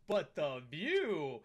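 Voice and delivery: slow, drawn-out mock admiration; mock dreamy character. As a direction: drag out the view like a dazed fan. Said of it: "and he goes".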